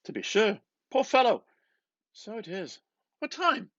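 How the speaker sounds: high, hearty voice of McCoy; McCoy consoling Bloom, high and hearty; McCoy's sympathy. A narrator's reading. McCoy sympathising about funeral.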